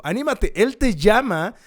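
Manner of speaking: loud, high rallying cry; acted, high-pitched summons; excited calling out. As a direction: call to the blind man with full urgency. Acts the crowd calling Bartimeo.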